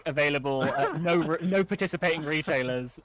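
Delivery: fast, loud legal fine print; mock ad disclaimer. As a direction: rattle off like a radio ad's small print.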